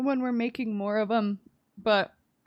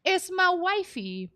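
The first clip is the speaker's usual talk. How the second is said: doting; 9 semitones higher than usual. Real, not acted. Doting on game wife.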